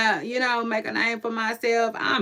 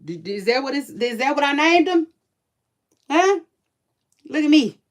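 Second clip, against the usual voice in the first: imitating own scolding; 5 semitones higher, 5 dB louder than usual. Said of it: "And I be like".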